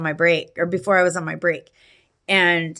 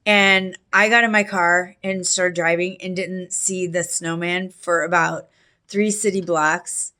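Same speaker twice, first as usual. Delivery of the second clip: ordinary talk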